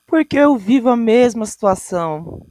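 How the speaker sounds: exclaiming. Real, not acted. Listener exclaims, loud.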